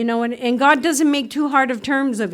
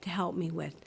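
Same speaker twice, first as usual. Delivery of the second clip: soft, hushed prayer under the breath; quietly pleading with God, barely voiced; still small voice; 4 semitones lower, 11 dB quieter than usual. Praying to God in a small voice, hushed and soft. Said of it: "the still small voice".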